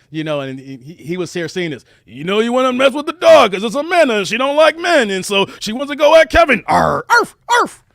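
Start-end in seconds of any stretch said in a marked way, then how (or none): 2.0-7.8 loud, higher, barking rapper voice. Retold or imitated. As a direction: growl and bark like a hype rapper. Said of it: "I imagine when D M X".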